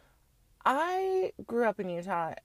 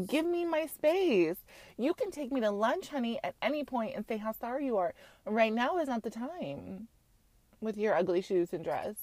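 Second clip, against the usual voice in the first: mock diva scolding; 3 semitones higher than usual. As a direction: brush her off with icy sass. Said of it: "I would have been like".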